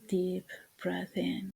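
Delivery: guided meditation. Acted.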